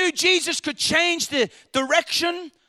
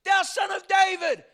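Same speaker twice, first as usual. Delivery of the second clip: high, drawn-out imitated cry; acted desperate plea for mercy; 7 semitones higher, slower than usual. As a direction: call out high and slow, building in volume. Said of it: "but he cried".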